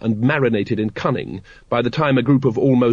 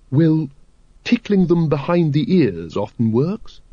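slow, considered advice in a lady's character voice; lady expert thinking aloud; Lady Ramkin; 4 semitones higher than usual. A narrator's reading. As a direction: muse helpfully, drawing out the tip. Lady Ramkin giving dragon advice.

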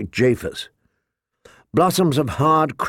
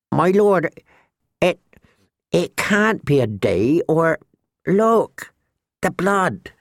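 put-on high, halting, nervous boy; 5 semitones higher, slower than usual. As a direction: stammer it out nervously. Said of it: "Sam's nervous, high-pitched voice".

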